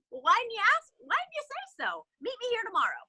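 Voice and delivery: high, lively mock Larry King. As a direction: play the old host suddenly won over. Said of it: "And Larry King goes".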